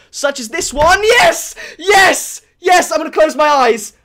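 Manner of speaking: high, loud burst of anticipation; excited outburst. Real, not acted. Player starting the next crate opening, excited and loud.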